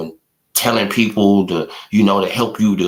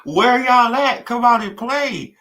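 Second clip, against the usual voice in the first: much higher, slow, singsong taunt; taunting call-out; 12 semitones higher than usual. Real, not acted. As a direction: call them out like a playground tease. Taunting the rival's supporters.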